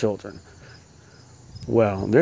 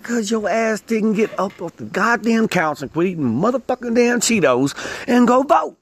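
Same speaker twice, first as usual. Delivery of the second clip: higher, rising into a yell at the end; exasperated rant; 9 semitones higher than usual. Real, not acted. To lazy non-voters, a rising exasperated yell. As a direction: scold the couch potatoes until it bursts out.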